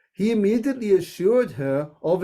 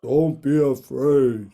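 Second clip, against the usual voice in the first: low and slow, a god's calm reassurance; deep voice; 5 semitones lower than usual. Shown as a demonstration. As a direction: drop the voice low and draw it out. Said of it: "Hey, come on, Namrata".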